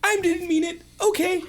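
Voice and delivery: high falsetto frantic apology; comic high-pitched begging; pleading Moo. Acted. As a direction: squeal out a desperate apology. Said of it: "And Moo goes".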